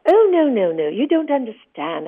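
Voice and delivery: high, loud, hurried "Oh, no, no, no, you don't understand"; a rattled director backpedalling fast; high, loud flustered retort to the actress; flustered protest. Retold or imitated. Director hastily correcting her.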